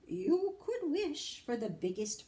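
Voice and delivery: lightly high leprechaun offer. A narrator's reading. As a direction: tempt him with a wish. Leprechaun voice continues.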